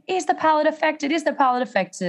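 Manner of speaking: anxious high cry of a new mum; retold frantic scream. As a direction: re-enact yelling at the doctors, half volume. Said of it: "I start screaming".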